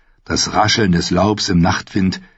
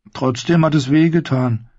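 higher-pitched mild grumble; complaining; 8 semitones higher than usual. A narrator's reading. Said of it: "beschwerte sich Sam".

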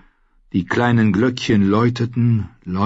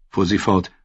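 usual speaking voice

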